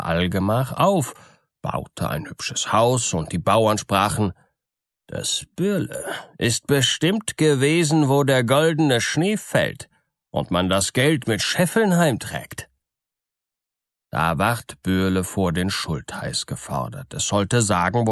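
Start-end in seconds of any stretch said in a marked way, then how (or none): 5.1-12.7 higher-pitched gossiping villagers; marvelling peasants. Acted. Peasants speculate about Bürle's riches, marvelling high voice.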